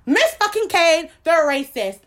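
Miss fucking Kane said slow, high and loud; outraged repeat. Real, not acted. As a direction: spit the name out in outrage. Repeating the name louder.

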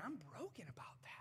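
a hushed, breathy whisper of regret; whispered confession. Pretend. Pastor voicing a sinner's private confession, whispered. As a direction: confess it under your breath.

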